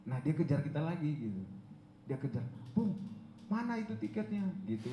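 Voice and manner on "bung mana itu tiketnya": high-pitched retold demand; pestering. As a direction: imitate a friend chasing you up. Caller chasing ticket, retold. (menagih dengan nada tinggi)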